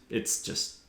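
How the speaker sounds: usual speaking voice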